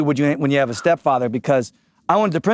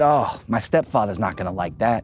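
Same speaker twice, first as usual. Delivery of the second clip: smug threat; 11 semitones lower, 3 dB quieter than usual. Retold or imitated. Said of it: "I said".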